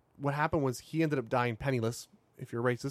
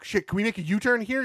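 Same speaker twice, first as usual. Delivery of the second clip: higher, worried question; nervous traveler; 8 semitones higher than usual. Retold or imitated. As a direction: ask in a panic. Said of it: "you know".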